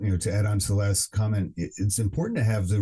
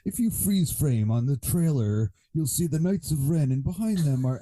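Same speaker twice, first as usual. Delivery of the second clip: mocking theory nerd; 5 semitones higher than usual. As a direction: lay out the theory like a breathless fan. Mocking a YouTube theory video.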